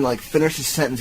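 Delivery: ordinary delivery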